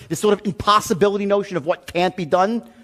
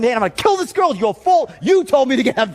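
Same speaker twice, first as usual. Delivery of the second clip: higher, ranting accusation; retold meltdown, blame them; freaking out; 5 semitones higher, 3 dB louder than usual. Man ranting at his relative about the agent, freaking out. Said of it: "Now I'm freaking out".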